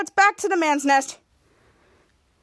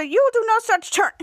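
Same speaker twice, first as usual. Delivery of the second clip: higher-pitched retort; acted scolding; 6 semitones higher than usual. Nana Winter forbids the runway turn, mild acted scolding.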